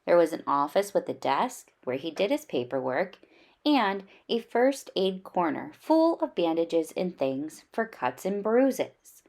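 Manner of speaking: plain reading voice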